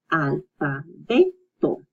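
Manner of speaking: "Alfabeto" slowly as al, fa, be, to; syllable by syllable. Shown as a demonstration. Teacher breaking down the word for alphabet, "Alfabeto" in slow pieces. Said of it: "And then you put it together".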